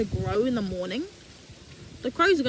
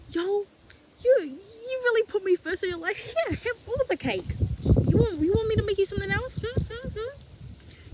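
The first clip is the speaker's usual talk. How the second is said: high, coaxing offers, pitched up; doting God voice; 6 semitones higher than usual. Pretend. God coaxing a devoted believer with food, high and doting. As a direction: fuss over them like a doting host.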